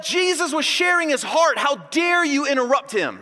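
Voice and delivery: high-pitched, hammed-up outrage; play-acted indignant protest; mock indignation. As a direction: scold as if deeply offended. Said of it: "they became indignant".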